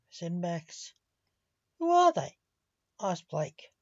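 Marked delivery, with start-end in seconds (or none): 1.8-2.4 sharp, high and loud alarmed question; sudden high startled ask. Acted. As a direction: blurt it out in shock. Blake startled by the video.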